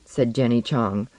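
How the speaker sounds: ordinary delivery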